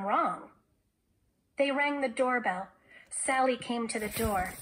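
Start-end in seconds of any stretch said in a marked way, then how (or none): none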